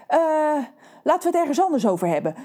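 rushed, fast aside; hasty change of subject. Real, not acted. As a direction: hurry past the awkward moment. Narrator hastily changes subject. (snel wegwuiven)

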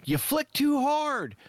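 high, slow mock-Thor delivery; imitating Thor's line. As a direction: squeak it out slowly, hamming up the hurt. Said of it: "his delivery of that was friggin' great".

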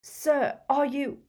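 high, tentative question cut off; anxious, high-pitched query; anxious hope. A narrator's reading. Harry sees Dumbledore's eyelids flicker.

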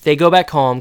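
ordinary talk, nothing marked

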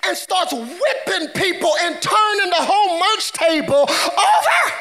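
rising to a high-pitched yell; building shout. Real, not acted. Preacher telling of Jesus flipping tables, building to a shout. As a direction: climb to a yelled last word.

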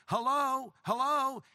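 yelled-in greeting, retold; mock yelling. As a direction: holler a drawn-out hello through a doorway. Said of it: "So he's yelling in".

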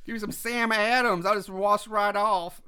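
put-on botched Boston accent drifting into twang; a muddled put-on regional accent; mock Boston accent. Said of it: "No, that's, that's Twang".

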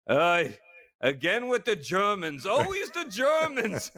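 mock sigh of a fed-up German astronaut; exasperated German. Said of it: "he's like".

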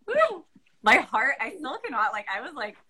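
high, thrilled woo; overwhelmed with excitement; excited squeal. Real, not acted. Gushing over reading the finished story.

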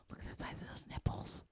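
hushed, breathy awe; whispering. Real, not acted. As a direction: whisper it in disbelief.

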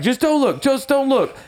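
high, pleading repeat; urgent plea. Retold or imitated. Retelling Joe shielding Parker from the wolves, high urgent plea. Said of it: "And he's like".

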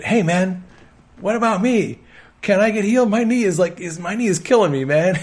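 pleading trainee. Retold or imitated. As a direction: pipe up high, asking for help.

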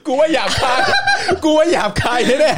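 words squeezed out through high laughter; can barely get it out for laughing. Real, not acted. Host mocking police slogan, breaking into laughter.

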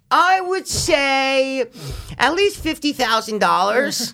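put-on high, very slow clueless kid; dumb kid voice. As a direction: drag it out like a naive kid. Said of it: "He's like".

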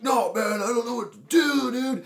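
high, loud distraught whine; acted panic. Stuck driver to friend who says chill, acted panic. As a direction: cry it out in hammed-up despair.

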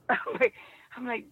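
ordinary delivery

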